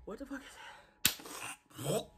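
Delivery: slow hushed whisper. Real, not acted. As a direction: breathe the words out slowly. Reacting to burning heat.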